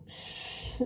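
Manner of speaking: a sharp whispered hush; the rat hushes Meg so nobody hears, whispered; hushing shh. Acted. Urgent whispered shh. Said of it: "said Roscura".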